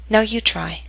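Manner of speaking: normal talking voice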